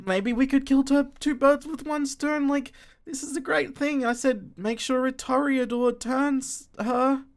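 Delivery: high, loud pleading confession; wailing mother. Acted. Mother wails her confession.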